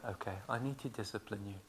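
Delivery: very soft, low and quick; calm quiet mother. Retold or imitated. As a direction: calmly tell a child, softly. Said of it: "she talks to them".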